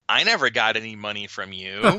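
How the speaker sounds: nasal impression, rising at the end; Al Bender. Guest quoting the recording's best line, nasal mimicry. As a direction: mimic an odd old recording. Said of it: "where Bender says".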